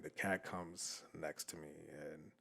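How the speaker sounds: slow right down and nearly vanish for suspense; hushed suspense. Real, not acted. Storyteller as the creepy cat closes in, hushed suspense. Repeats line to build suspense.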